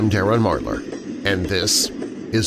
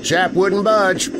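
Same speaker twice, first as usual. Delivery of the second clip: higher, uneasy working man's voice; gruff train engineer; 8 semitones higher than usual. Acted. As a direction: shrug it off but with the willies. Train engineer answers his mate.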